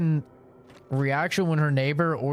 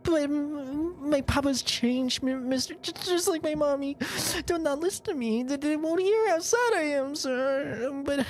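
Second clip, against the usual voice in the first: put-on higher, halting little boy voice with stammers; timid stuttering boy; 14 semitones higher than usual. Violin boy nervously telling the stranger about the pretty lady, higher and stammering. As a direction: stammer shyly like a nervous child.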